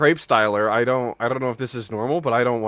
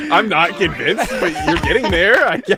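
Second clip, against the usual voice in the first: laughing; 6 semitones higher, 4 dB louder than usual. Real, not acted. Laughing while teasing a friend.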